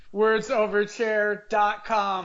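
slow, deliberate web address; slow announced URL. Shown as a demonstration. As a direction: spell out the address slowly for listeners. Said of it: "go the other way".

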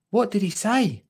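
high-pitched curious question; Lottie's child voice. Acted. Lottie asks Dottie what he said, high child voice. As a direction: ask in a high child character voice. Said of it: "said Lottie".

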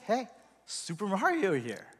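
soft, offhand hello; casual, quiet self-introduction; Mario introducing himself. Acted. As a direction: toss it off softly, like a modest greeting. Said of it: "he's just saying".

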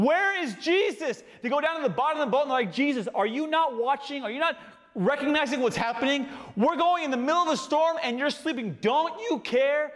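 high, frantic cries of scared fishermen; high, panicked pleading; panicked disciples. Acted. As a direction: plead like men about to drown.

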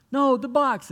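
put-on the little boy losing patience; exasperated child. Nephew insisting louder.

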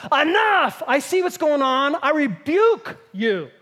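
loud, high, forceful rebuke; commanding rebuke. Acted. Jesus stops the enemy meddling, commanding rebuke.

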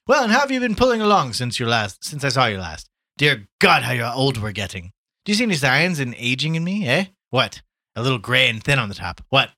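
high, loud, breezy greeting; hearty Gallagher, hailing a friend. Acted. Gallagher hails his old friend Tommy at the bar, hearty. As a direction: boom it out like a back-slapping bar regular.

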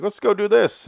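high, loud cartoon cheer; all happy. Uncle Harry after the flips, hammed-up happy and eager. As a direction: chirp it up in mock glee. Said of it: "he's, like, all happy".

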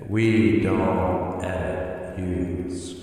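slow, drawn-out echoing words; digital echo. Shown as a demonstration. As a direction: stretch each word like an echo. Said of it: "We need an echo effect".

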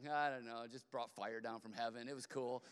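low, deadpan understatement; nonchalant. Acted. Elijah plays down bringing fire from heaven, nonchalant. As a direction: shrug off something huge, voice dropped.

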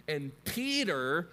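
normal talking voice

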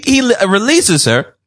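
ordinary talk

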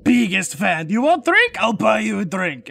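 hammed-up drunken fan, loud and swooping; drunken shout. Drunk brother greeting his favourite author, shouting excitedly. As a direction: shout like a tipsy superfan. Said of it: "stumbles over and he shouts".

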